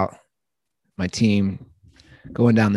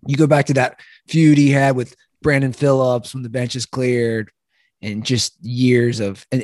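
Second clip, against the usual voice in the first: usual speaking voice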